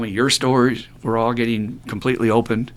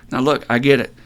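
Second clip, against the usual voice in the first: ordinary talk, nothing marked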